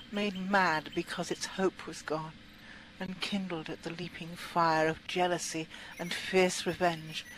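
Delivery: ordinary delivery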